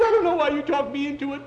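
high, loud complaint; scared boy's shrieked protest; panicky quarrel. Acted. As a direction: blame your friend at the top of your voice. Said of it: "Now we're fighting".

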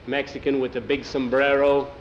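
ordinary delivery

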